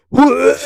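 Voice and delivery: high gagging What; disgusted gag. Retold or imitated. Reading his text, gagging in disgust. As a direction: retch out the word in disgust. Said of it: "I'm gonna do it again".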